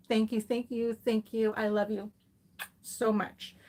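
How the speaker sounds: quiet heartfelt thank-yous; gentle grateful murmur; soft sincere thanks. Real, not acted. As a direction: thank them softly, near tears. Tearful thanks to coworkers.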